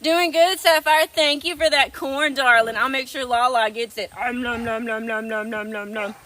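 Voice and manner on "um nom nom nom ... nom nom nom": playful munching nom nom sounds, trailing softer; munch it out like a hungry little animal; eating noises. Pretend. Pretending to eat on stream.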